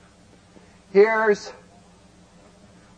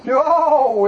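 ordinary talk, nothing marked

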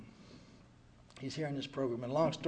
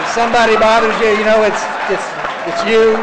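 high, loud pleading as the wife; mock wife insisting; 7 semitones higher, 20 dB louder than usual. Wife telling her husband the problem is him, loud pleading. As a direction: voice the wife's exasperated "it's you".

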